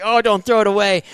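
urging himself not to blow the race; urgent self-talk. Retold or imitated. Rider mid-race to himself after a mistake, alarmed high cry.

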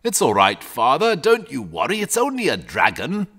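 put-on mildly drowsy, unworried boy; sleepy. A narrator's reading. Sleepy boy soothing his father about the dragon, unconcerned. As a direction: reassure him with a touch of sleepiness. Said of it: "and said sleepily".

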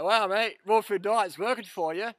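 sarcastic mates. Retold or imitated. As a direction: tease him with fake praise. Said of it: "And they're like".

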